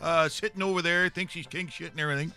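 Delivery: high, loud, blustering hockey commentator voice; ranting hockey pundit character. Acted. Pundit tells panel who'll be a great player, ranting character voice. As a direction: bluster on and let nobody interrupt.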